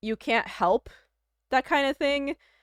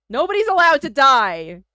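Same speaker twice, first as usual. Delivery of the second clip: loud playful decree; 5 semitones higher, 12 dB louder than usual. Real, not acted. Playful exclaiming to chat.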